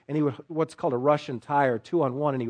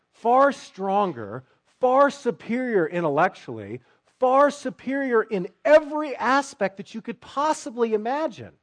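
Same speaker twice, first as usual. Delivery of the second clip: full-force preaching; 6 semitones higher than usual. Acted. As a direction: preach it hard to the congregation. Preacher applies story to congregation.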